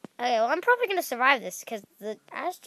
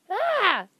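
startled yelp; 8 semitones higher, 5 dB louder than usual. Real, not acted. Player panics at sword-wielding attacker.

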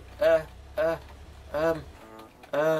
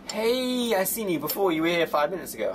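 greeting of recognition; 6 semitones higher than usual. Pretend. Attendant spotting a returning customer, high hearty greeting. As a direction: hail a familiar face with a high, falling call.

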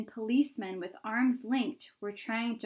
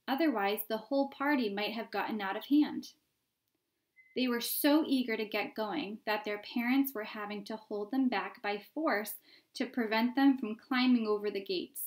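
ordinary delivery

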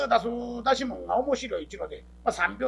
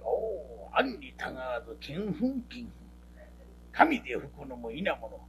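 lightly voiced, lower formal mutter; a hint of the stiff storyteller; pompous storyteller; 7 semitones lower, 3 dB quieter than usual. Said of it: "講釈師". (少し低めの講釈師の口まね)

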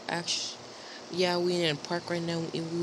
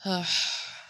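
short breathy sigh; quick role-play sigh; sad sigh. Pretend. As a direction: let out a pretend-sad huff.

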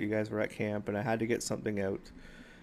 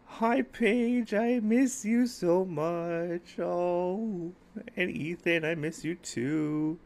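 emotional, tender; 9 semitones higher, 3 dB louder, much slower than usual. Real, not acted. Minecraft vlogger greeting little Paige and Ethan, high and tender. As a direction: sweetly coo to a niece you miss. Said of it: "I'm sorry for getting all emotional there".

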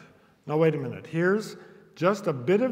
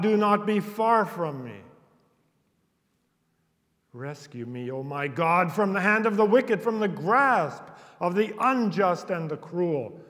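psalm reading; 2 semitones higher, 6 dB louder than usual. Acted. Preacher reading psalm pleas to the congregation, urgent and pleading. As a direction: beg God not to be far.

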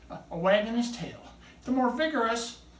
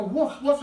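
louder, higher woof woof; retold dog bark; 6 semitones higher, 6 dB louder than usual. Showing the audience how he barks when told, loud woofs. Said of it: "I go".

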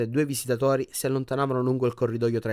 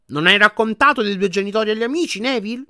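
higher, louder cutting grandmother; sharp reproach; 9 semitones higher, 6 dB louder than usual. A narrator's reading. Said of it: "in tono tagliente". (rimprovero tagliente, voce acuta)

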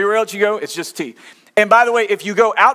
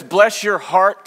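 slow Southern saying; much slower than usual. Retold or imitated.

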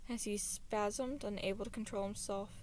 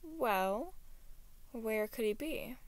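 ordinary delivery, nothing marked

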